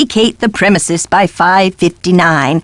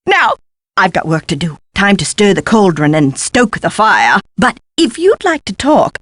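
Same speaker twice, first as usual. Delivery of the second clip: normal talking voice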